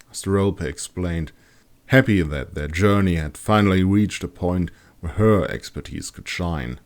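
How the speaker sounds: ordinary delivery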